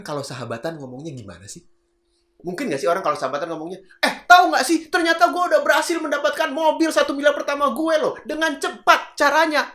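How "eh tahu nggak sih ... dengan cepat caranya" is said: high, loud monotone shouting. Shown as a demonstration. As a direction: shout it all out to a friend, hammed-up. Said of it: "ngomongnya cuma teriak-teriak doang". (teriak-teriak lantang dengan nada tinggi)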